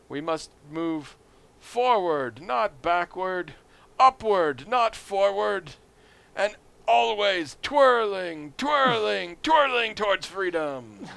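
slow, high, grandiose oratory; Bob Dole stump speech. Retold or imitated. Alien candidate rallying voters, slow hammed-up oratory. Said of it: "later Bob Dole gives the speech".

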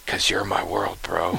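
soft, low intimate whisper. Acted. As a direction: whisper it tenderly to your buddy. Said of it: "Bro, why'd you whisper it".

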